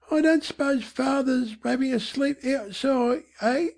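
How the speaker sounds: put-on high, soft young Cockney boy; boy's voice. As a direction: venture it as a child, pitched high. Boy questions mother in story.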